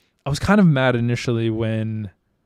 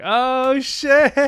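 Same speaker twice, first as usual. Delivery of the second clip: high, loud hyped-up "oh shit"; hype reaction; 14 semitones higher, 6 dB louder than usual. Retold or imitated. Hyping up a buddy's fresh cut, high excited shout. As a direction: gas up a friend's fresh haircut. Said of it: "you hype your boys up".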